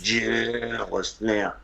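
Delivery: drawn-out, disdainful JOURNALIST. Shown as a demonstration. Co-host showing scorn for the media, disdainful. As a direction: stretch the first syllable of journalist with open contempt. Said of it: "my disdain for the media".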